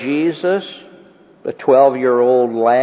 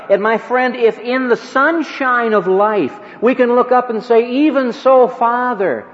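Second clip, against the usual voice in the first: higher and louder, building fervent preaching; preaching climax; 10 semitones higher, 3 dB louder than usual. Acted. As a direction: lift the prayer into a ringing climax. Preaching at full force.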